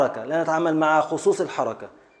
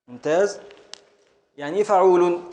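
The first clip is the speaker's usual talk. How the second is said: usual speaking voice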